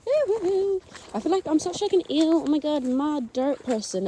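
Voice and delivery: high squealing ew; grossed-out shriek; disgusted squeal. Real, not acted. Disgusted by mud.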